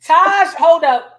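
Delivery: loud, high call to stop. Real, not acted. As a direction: cut in and call her name. Interrupting, calling out.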